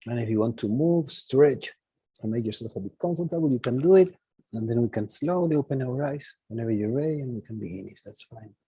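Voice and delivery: soft, low meditation guide; guided meditation. Acted. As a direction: ease them gently out of stillness.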